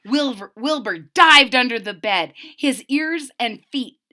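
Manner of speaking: ordinary delivery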